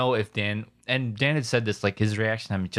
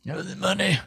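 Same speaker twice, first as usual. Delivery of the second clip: high-pitched cartoon alien trader voice; goading mock-character line, pitched well up; Watto; 10 semitones higher than usual. Co-host baiting the quizmaster into an impression, Watto voice. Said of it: "I cannot do a quiet Watto impression".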